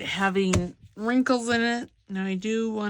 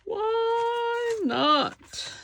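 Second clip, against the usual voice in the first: Why not? popped way up high, falling on why; playful high why not. Pretend. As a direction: squeak "Why not?" up high like a cheeky kid. Asks herself why, answers playfully.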